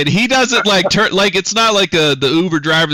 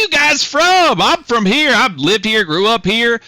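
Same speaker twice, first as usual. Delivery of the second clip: high, raised country driver voice; folksy, nosy trucker pitched way up; chatty truck driver; 11 semitones higher, 4 dB louder than usual. Acted. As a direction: holler small talk to your passengers. Game master plays driver.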